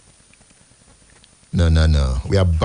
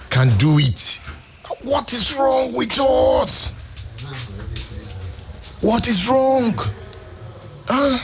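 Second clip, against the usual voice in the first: rising to a pitched, drawn-out cry of what is wrong; exasperated plea to God; slower than usual. Retold or imitated. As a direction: cry out to God in frustration, slowly. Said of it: "I said".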